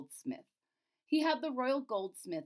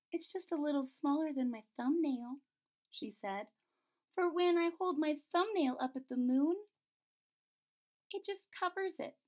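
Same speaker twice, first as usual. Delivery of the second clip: cartoon princess; 6 semitones higher than usual. Princess voiced in story.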